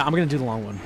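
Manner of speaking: ordinary delivery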